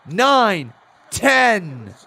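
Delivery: loud, high commentator calling the count; excited play-by-play. Acted. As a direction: call the action like a wrestling announcer. Calls the match like an announcer.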